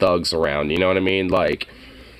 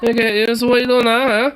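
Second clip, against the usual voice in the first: mock British accent; 13 semitones higher than usual. Streamer parroting the homeowner to the officers, mock British accent. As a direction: echo his words in a put-on British accent.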